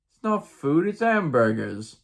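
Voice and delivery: mock British, starting high; mock British accent. Missionary answering his district leader, mock British accent. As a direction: put on a silly British accent. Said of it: "said with a mock British accent".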